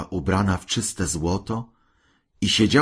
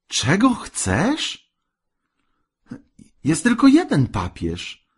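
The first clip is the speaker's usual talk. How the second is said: high, stretched-out disbelief; appalled, high-pitched objection; incredulous husband; 12 semitones higher than usual. A narrator's reading. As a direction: protest in astonishment, voice leaping up.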